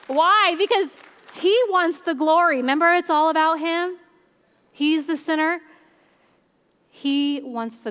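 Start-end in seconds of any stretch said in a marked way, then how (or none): 0.1-5.6 rising preacher's emphasis. Real, not acted. Preaching point raised loud.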